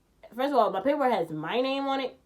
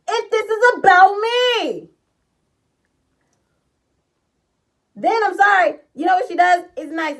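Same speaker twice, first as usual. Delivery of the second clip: much higher and louder, indignant yelling; furious rant; 9 semitones higher, 11 dB louder than usual. Retold or imitated. Raging at her for leaving with Danny, angry shouting. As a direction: go off on her, outraged. Ranting about Danny riding along.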